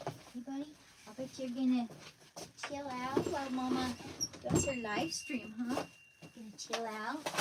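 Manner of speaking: hushed tender voice settling her dog; soft pet talk. Real, not acted. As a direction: gently coax the dog to settle.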